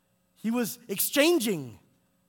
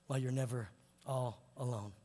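ordinary talk

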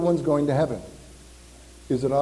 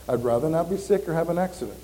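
normal talking voice

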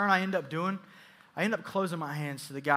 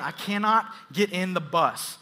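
ordinary delivery

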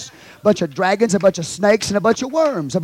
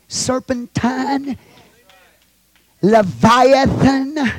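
drawn-out emphasis; 10 semitones higher, 5 dB louder, much slower than usual. Real, not acted. Preacher dwells on words slowly.

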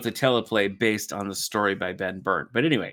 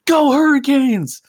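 cheering shout; 10 dB louder, slower than usual. Retold or imitated. Kid shouting at stranger in line.

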